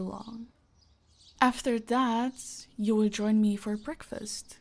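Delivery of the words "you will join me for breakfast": ordinary talk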